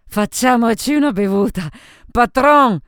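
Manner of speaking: high, loud hail across the bar; rowdy shout, peaking on the last word; drunken call for drinks. A narrator's reading. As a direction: holler for the owner. Drunk Sophie calls for the owner.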